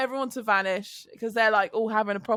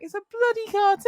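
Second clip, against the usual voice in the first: exasperated; 9 semitones higher, 3 dB louder than usual. Retold or imitated. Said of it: "I was like".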